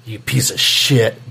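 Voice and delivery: low, slow, whispered menace; judge quote, hushed. Retold or imitated. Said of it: "and he's all".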